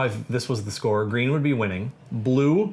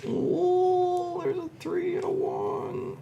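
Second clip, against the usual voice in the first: high surprised ooh; 3 dB quieter, much slower than usual. Real, not acted. Tense draw from the bag.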